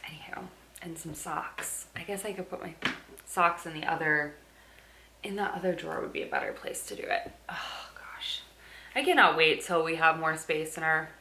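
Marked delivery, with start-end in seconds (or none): 0.1-2.7 whispering. Real, not acted. Whispered aside. 6.0-8.4 whispering. Real, not acted. Whispered aside.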